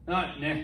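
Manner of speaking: "nah" as a short, low grunt of no; slurred shorthand. Retold or imitated. Colleague answering about lunch, slurred shorthand. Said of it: "we can shorten things".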